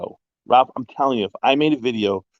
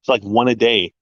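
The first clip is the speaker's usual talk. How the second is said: ordinary talk, nothing marked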